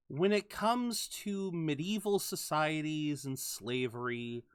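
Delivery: ordinary talk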